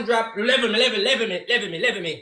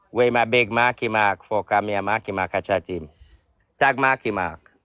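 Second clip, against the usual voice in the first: ordinary talk, nothing marked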